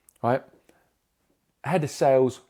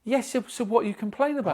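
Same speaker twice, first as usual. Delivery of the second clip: raised and quick, exasperated comeback; heated fast retort; 9 semitones higher, faster than usual. Retold or imitated. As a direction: fire it back fast and pitched up. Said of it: "and was getting really angry".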